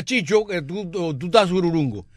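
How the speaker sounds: usual speaking voice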